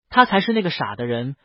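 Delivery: usual speaking voice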